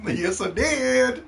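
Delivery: high, slow put-on voice. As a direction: stretch it out in a silly high voice.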